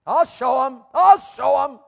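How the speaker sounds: loud, high acted sulk; mock defiant shout; pouting defiance. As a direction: stomp it out like a pouting teen. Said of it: "You ever pouted?".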